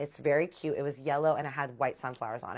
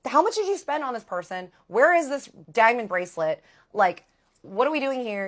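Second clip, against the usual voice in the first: higher and louder, demanding; angry confrontation; 7 semitones higher, 9 dB louder than usual. Retold or imitated. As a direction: confront him and demand answers. Confronting the ex over bracelet.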